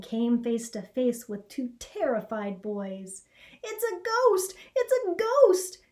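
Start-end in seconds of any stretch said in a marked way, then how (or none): 3.6-4.5 high, loud frightened shriek on ghost; terrified scream. A narrator's reading. Two boys fleeing the ghost, screaming high. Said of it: "they both screamed as they fled". 4.7-5.8 even higher and louder panicked shriek; terrified scream. A narrator's reading. Boys screaming again as they run, higher still. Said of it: "they both screamed as they fled".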